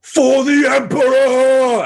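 high, drawn-out battle scream; screamed battle cry. Acted. Player's trooper charging through the door, screamed battle cry. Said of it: "I scream".

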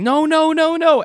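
high frantic yell of no; screaming. Retold or imitated. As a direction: scream it in a panic. Teacher screaming at kid.

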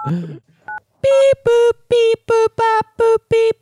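high, sung beep boop tones; phone beeps. Acted.

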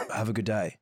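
put-on low, understated British accent; lightly imitated British voice, pitched low. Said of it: "in his voice".

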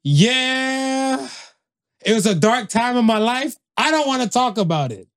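hammed-up dodging of a painful past; mock evasive reply. Imagined ninety-year-old answering about his past, mock evasive. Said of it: "You be like".

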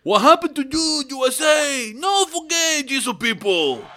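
put-on high loud Cuban accented English. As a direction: do Yoel Romero's Cuban accent. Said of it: "Best Cuban accent".

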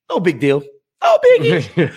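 mock airy "No biggie" jumping very high with a laugh; sarcastic. Host mocking the budget cuts to his co-host, squeaky sarcasm. Sarcastic about drug costs.

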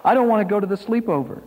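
put-on high, fast child's plea; hurried, high-voiced protest; begging protest. A narrator's reading. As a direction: rush it out like a pleading little boy, pitched high. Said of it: "Richard begged his brother".

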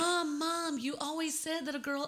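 high-pitched daughter pleading to Mom. Acted. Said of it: "that's whenever the daughter's like".

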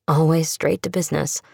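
ordinary delivery, nothing marked

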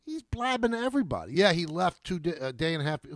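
ordinary talk

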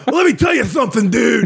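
high shouted lisping wrestler promo; Hulk Hogan with a lisp. Retold or imitated. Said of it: "What if Hulk Hogan had a lisp?".

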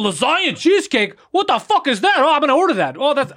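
high, loud mock excitement over the menu; mocking friend at menu. Mocking his friend reading the menu, high and loud. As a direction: ham up a clueless friend discovering the dessert. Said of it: "he's like".

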